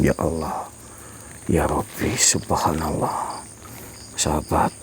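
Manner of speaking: whispered prayers, barely voiced; frightened whisper. Real, not acted. Vlogger whispers prayers beside the ghost, frightened. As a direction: murmur to God under the breath.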